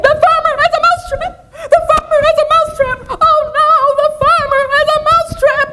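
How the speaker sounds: very high, loud, half-sung mouse wail; frantic mouse. Acted. Mouse warning the barn animals in a shrill frantic wail. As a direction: squeal the news in a laughing panic. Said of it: "he said".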